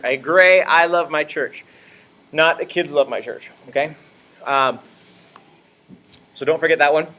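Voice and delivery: normal talking voice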